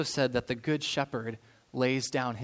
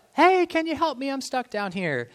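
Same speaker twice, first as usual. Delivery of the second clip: high, loud call for help from below; shouting up; 9 semitones higher, 5 dB louder than usual. Retold or imitated. Man stuck in a hole calls up to a passing doctor, shouting. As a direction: yell up from the bottom of a hole. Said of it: "the guy shouts up".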